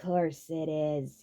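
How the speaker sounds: very slow, drawn-out sarcasm; deadpan sarcastic. A narrator's reading. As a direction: drip with sarcasm. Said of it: "he said sarcastically".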